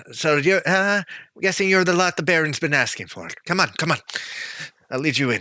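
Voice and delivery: light in-character voice of a woman waving guests in; barkeeper character. Acted. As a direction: usher newcomers in, a touch brisk. Game master voices the barkeeper.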